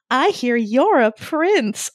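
slow, high gasping princess voice; breathless princess. Retold or imitated. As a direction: gasp it out like a delighted princess.

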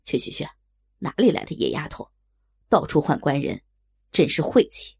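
grumbling waiter. A narrator's reading. Impatient waiter shooing away a girl who calls outside, grumbling. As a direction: complain in a deep waiter's voice. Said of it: "口中埋怨道".

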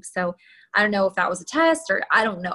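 normal talking voice

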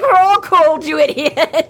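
Murphy snapping at the dramatic Harry; exasperated snap. Retold or imitated. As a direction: bite his head off like a fed-up friend.

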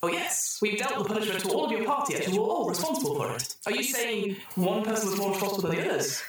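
the computer rewarding the troubleshooters, in a chirpy synthetic voice; cheery computer voice. Acted. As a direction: sing out the congratulations like a cheerful machine.

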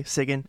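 "Sigan" as SIG-in, with a light A; "Sigan" ending on a short, light vowel. Shown as a demonstration. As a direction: say "Sigan" with the A barely sounded. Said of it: "like a light A".

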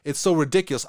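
ordinary talk

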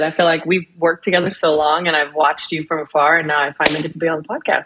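ordinary delivery

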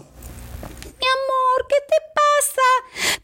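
high, slow, sweet wife voice; high drawn-out mi amor from a worried wife. Retold or imitated. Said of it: "le pregunta al esposo".

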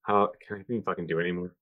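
ordinary talk, nothing marked

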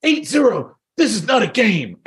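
high-pitched mock tirade; imitated Russian yelling. Russian dad turning on all the kids in the room, mock yelling. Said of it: "So it's getting louder and louder".

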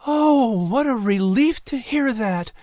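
high, breathy-free sigh of relief as the mother; mother voiced high with relief; relieved Sarah. A narrator's reading. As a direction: let the mother's relief lift her voice high. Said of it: "Sarah said, placing her hand over her heart".

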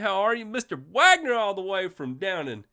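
usual speaking voice

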